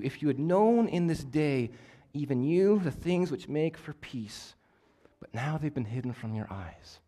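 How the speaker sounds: quiet, slow, sorrowful words of Jesus; soft grieving lament. Acted. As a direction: let the lament fall hushed and heavy over the city. Jesus weeping over the city.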